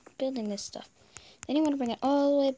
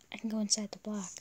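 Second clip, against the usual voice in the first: whispered aside; 3 semitones lower, 7 dB quieter, faster than usual. Real, not acted. Mutters to himself while trying.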